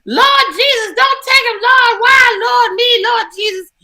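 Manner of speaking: high, loud hammed-up wailing; mock wailing mourner. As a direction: wail like a grieving church aunt. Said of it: "it is from the".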